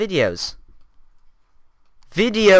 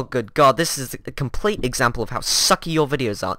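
usual speaking voice

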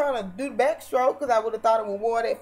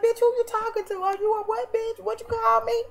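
very high mocking helium pitch; piercingly high, mock-indignant comeback; mock squeaky retort. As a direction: snap back in an exaggerated high voice. Mock exchange about her irritating voice.

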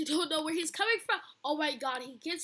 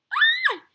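loud, very high shriek; startled scream; 12 dB louder than usual. Real, not acted. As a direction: shriek as the monster appears. Startled during scary game.

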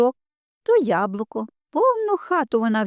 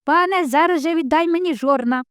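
shouting rooster; 6 semitones higher than usual. A narrator's reading. Rooster, out of the cowshed, shouts at the lord again. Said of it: "кричить, гукає".

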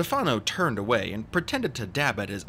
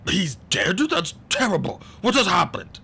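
loud, higher-pitched dismay; startled, raised voice; shocked; 9 semitones higher, 6 dB louder than usual. A narrator's reading. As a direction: react in shock at bad news. Man shocked at news of death.

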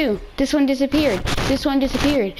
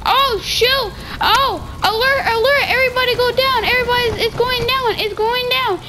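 mock alarm call; 7 semitones higher than usual. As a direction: sound the alarm like the plane's going down. Calling alarm to teammates.

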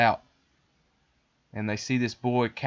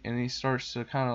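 usual speaking voice

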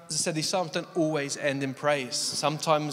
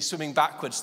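ordinary talk, nothing marked

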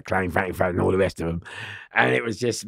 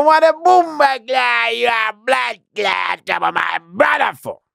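loud, high, slow Jamaican patois yell; shouted Jamaican patois; 12 semitones higher, 5 dB louder, slower than usual. Retold or imitated. Gang member shouting in front of the yard, Jamaican patois. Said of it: "So he go".

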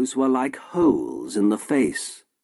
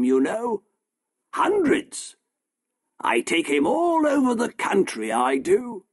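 higher, proud showman's sales pitch; boastful shopkeeper; 7 semitones higher than usual. A narrator's reading. Shopkeeper boasts to the doctor about his elephant man, proud. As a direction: sell the freak show to the doctor.

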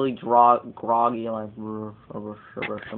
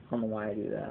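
ordinary talk

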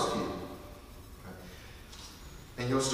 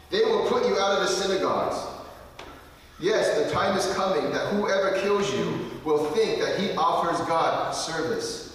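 loud, raised-voice reading of the verses; loud scripture reading; 4 semitones higher, 4 dB louder than usual. Acted. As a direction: proclaim the warning to the congregation. Reads the scripture aloud.

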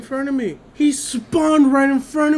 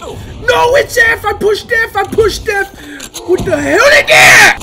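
high loud angry complaints; frustrated yelling; 10 semitones higher, 7 dB louder, slower than usual. Real, not acted. Gamer raging that his button didn't work, angry yelling. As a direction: rage at the game.

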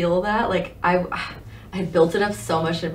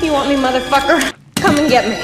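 defiant movie heroine; acting out the heroine; 4 semitones higher, 4 dB louder than usual. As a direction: taunt the killer, hammed up.

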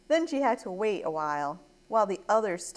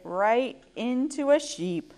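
ordinary delivery, nothing marked

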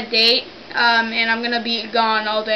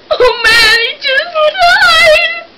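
hammed-up wail, much higher, drawn out slowly; fake anguish; 6 dB louder, much slower than usual. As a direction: howl it like a fake grief scene. Prank caller fakes an emergency.

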